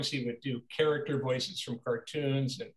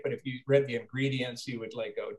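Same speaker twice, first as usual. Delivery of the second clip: usual speaking voice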